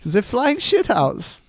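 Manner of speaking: high, slow falsetto German caricature; squeaky comic German accent; camp German accent. Acted. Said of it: "they always sound so camp".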